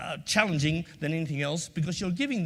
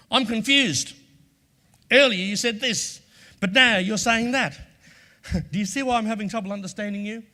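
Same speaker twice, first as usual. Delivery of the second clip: mock confused challenge; 6 semitones higher, 7 dB louder than usual. Shown as a demonstration. Demo phrase to challenge inconsistencies.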